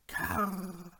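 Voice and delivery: soft, eerie ghostly call; an otherworldly voice drifting up from below; voice from the depths. A narrator's reading. A cave voice calling to a startled treasure hunter, eerie and hushed. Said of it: "eine Stimme sich aus der Tiefe vernehmen ließ".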